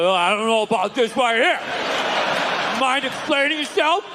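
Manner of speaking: slow, drawn-out mock disapproval; judgmental God. God watches the DVD of your life, slow and disapproving. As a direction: play God reviewing a bad scene. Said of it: "He's like".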